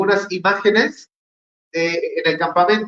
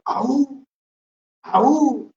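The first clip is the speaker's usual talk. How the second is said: high yelped war cry, held back a little; mock Spartan war cry; 8 semitones higher than usual. Preacher imitating the Spartans of 300 with a restrained high war yelp. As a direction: yelp "Aú" twice like a Spartan soldier.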